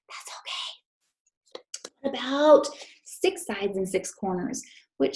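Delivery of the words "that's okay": soft reassuring whisper. Real, not acted. Teacher reassures kids softly.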